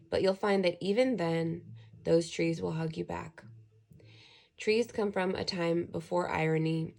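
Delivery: ordinary talk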